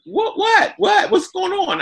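high, startled repeated what. Retold or imitated. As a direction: squeak it out like caught off guard. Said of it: "He like".

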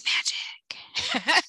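long, drawn-out whisper; slow, voiceless mock-magician reveal; showy stage whisper. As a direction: whisper it with jazz-hands flourish. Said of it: "With jazz hands".

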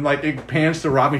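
ordinary delivery

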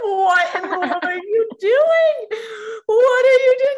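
high, loud, drawn-out shriek; shrieking disbelief. Retold or imitated. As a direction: squeal it in shock. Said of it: "I was like".

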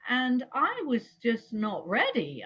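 ordinary delivery

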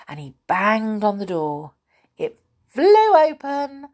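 ordinary delivery, nothing marked

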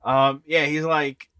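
usual speaking voice

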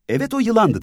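put-on high little girl's voice; child voice. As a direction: back up your friend like a small girl.

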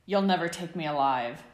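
loud hammed-up battle bellow. A narrator's reading. James play-fighting Sirius with pillows, mock-dramatic bellow. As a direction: bellow it like a cornered outlaw. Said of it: "James bellowed".

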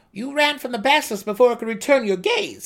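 high, loud reasoning gnome. A narrator's reading. Seldig reasons aloud to the dark elf, high and loud. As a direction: work it out aloud, pleased. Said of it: "Seldig reasoned".

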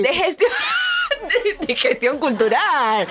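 cracking up mid-phrase; laughing repeat. Real, not acted. Co-host echoes the guest's cultural-management line, laughing. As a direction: repeat it through a burst of laughter.

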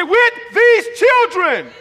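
high, loud, drawn-out shout; fired-up preacher's cry; angry, upset preaching. Shown as a demonstration. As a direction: hammer each word slowly at full voice. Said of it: "get angry and get upset and say".

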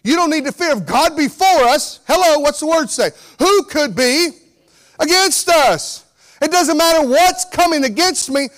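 fervent pastor driving it home; full-force preaching. Acted. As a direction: preach it up to the rafters.

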